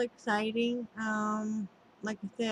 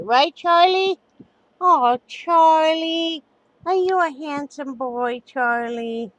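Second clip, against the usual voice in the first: talking to a dog; 7 semitones higher, 9 dB louder, slower than usual. Real, not acted. Showing pets, baby talk.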